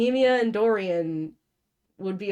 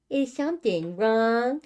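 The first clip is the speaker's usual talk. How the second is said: slow, high sugary tone; sweet with a hidden barb; sweetly; 4 semitones higher, slower than usual. Acted. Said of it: "she asked sweetly".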